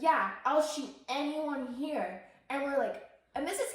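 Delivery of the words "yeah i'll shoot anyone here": put-on loud, slow drunken threat; menacing drunk. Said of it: "And then he's like".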